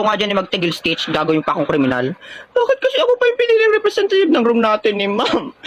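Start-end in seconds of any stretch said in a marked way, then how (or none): 2.5-5.5 high mock whiny voice, imitating her; mocking imitation. Friend mimicking Turjang's nervous complaint, high mock voice. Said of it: "Nanggaya lang naman ako sa English nun".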